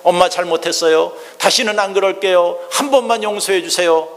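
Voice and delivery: high, loud begging through tears; child's pleading cry. Retold or imitated. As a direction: plead like a sobbing little boy. Child begging mother at locked door. (울며 애원하는 아이 목소리)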